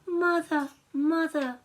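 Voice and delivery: wolf cub spotting its mother; cub calling mother. Acted. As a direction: call out to her with relief.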